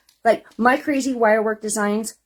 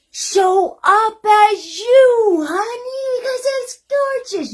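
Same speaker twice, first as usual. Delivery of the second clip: high, loud, slow cooing; gushing encouragement; 13 semitones higher, 7 dB louder, slower than usual. Real, not acted. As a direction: lift the viewer up with a high loud coo. Encouraging her viewers.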